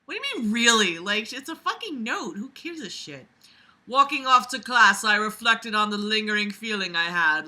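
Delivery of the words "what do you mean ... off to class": ordinary talk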